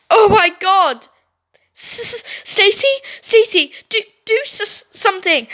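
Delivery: high, loud, stammering; panicked shriek. Acted. Brittany begs Stacy to help, high and panicked. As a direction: stammer out a terrified plea.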